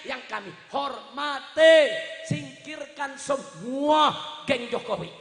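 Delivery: slow, loud oratory with stretched endings; drawn-out, forceful public address; impassioned appeal. Real, not acted. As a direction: call out to a leader over a crowd.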